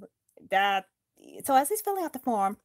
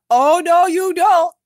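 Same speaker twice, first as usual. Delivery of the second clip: menacing loud; 7 semitones higher, 13 dB louder than usual. Acted. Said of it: "said Azog".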